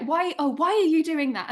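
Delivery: mock despairing scold; imitating a fed-up teacher; exasperated adult. Imitating scolding rhetorical question.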